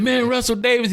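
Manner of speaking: star-struck exclaim; excited. Retold or imitated. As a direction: burst out with a fan's excitement. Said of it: "he got all excited".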